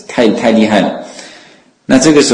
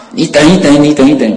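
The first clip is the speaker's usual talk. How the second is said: higher-pitched, mildly raised mock call; retold calling out; 5 semitones higher than usual. Pursuer calls after the Buddha ahead, mildly raised retold call. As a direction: imitate calling after someone you can't catch. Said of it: "追不上他就开始喊".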